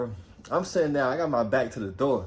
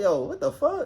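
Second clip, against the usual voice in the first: much higher incredulous yelp; disbelieving outburst; 13 semitones higher than usual. Retold or imitated. Reacting to being blamed, high incredulous yelp. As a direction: squeak out your disbelief.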